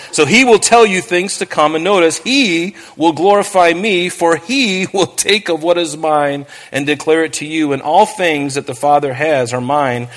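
measured, solemn Bible reading; scripture reading. Acted. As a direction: read the verses with weight, slowing on key lines. Reads scripture aloud in sermon.

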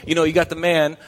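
ordinary delivery

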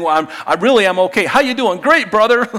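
hammed-up false cheer; fake cheerful. Churchgoer hiding pain behind chirpy small talk, fake cheerful.

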